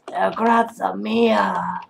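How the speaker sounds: high, drawn-out fake Spanish accent; slow, put-on Spanish flourish; mock Spanish. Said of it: "I should say something Spani-, Spanish".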